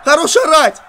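"Хорош орать!" yelled loud and high; annoyed shout. Real, not acted. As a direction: snap back loudly at the noise. Monster screams, player yells back.

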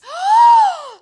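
loud, high theatrical gasp; shocked gasp. Acted. Storyteller hears witch will be eaten, horrified gasp. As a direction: gasp in mock horror. Storyteller reacts to dragon's threat.

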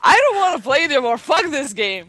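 fast frustrated rant; raging gamer. Real, not acted. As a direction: blurt it out in frustration. Rage quit moment.

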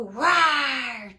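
long, loud roar mid-question; playful big lion sound. Acted. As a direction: stretch the word into a roar. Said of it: "says the lion".

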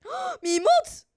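high, loud scared wail; frightened cry. Real, not acted. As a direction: cry out in fear. Zombie climbing toward her.

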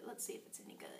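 put-on hushed aside to the children; whisper. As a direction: whisper it like a shared secret.

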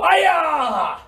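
sharp karate kiai yell; karate shout. Shown as a demonstration. Teacher leading kids in a karate yell. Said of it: "everybody go".

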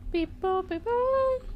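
high-pitched robot beeping; robot beeps. Pretend. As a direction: beep like a cute little robot. Robot noises, pitch jumps.

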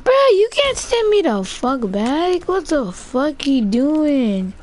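high, slow, disbelieving what the fuck; angry gamer yelling at the teammate; outraged. Real, not acted. Teammate sends him back.

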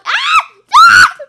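a sudden very high, very loud scream; shrill scream. Real, not acted. Player shrieking when the hunter appears. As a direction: shriek in pure fright.

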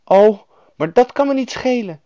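put-on high, loud defiant girl; brave. A narrator's reading. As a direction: declare it boldly as a plucky child. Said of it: "zei Gerda dapper".

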